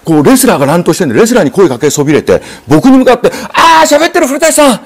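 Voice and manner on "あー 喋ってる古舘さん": high, slow, pleading innkeeper's voice; landlady begging the announcer to stop the brawl, high and pleading; crying innkeeper. Retold or imitated. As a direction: mimic a tearful landlady begging for help. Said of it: "泣きながら".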